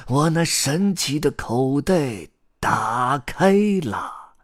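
high, slow, drawn-out spell on "打开了"; magic incantation. A narrator's reading. Grandpa Storyteller opens his magic pocket for the chicks, incanting grandly. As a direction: proclaim the opening with stretched syllables. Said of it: "开始念起了他的魔法咒语".

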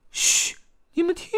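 very high little voice, hushing; hushing the others. A narrator's reading. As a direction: shush them, then squeak to listen. Character hushes friends to listen.

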